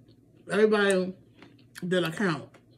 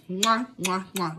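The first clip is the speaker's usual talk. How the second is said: blowing kisses; slower than usual. Real, not acted. Blowing kisses goodbye to viewers.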